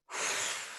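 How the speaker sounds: a long soft unvoiced exhale; demo sigh. Shown as a demonstration. Teacher shows listeners how to release breath, soft sigh. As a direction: let the breath go in an audible sigh. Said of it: "let out your breath".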